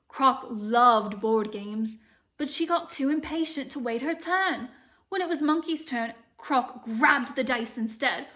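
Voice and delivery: story reading with an imitated British lilt; American trying a British accent; amateur British accent. Shown as a demonstration. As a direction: read aloud in a patchy British accent. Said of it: "practicing reading this book in a British accent".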